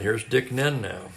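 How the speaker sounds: usual speaking voice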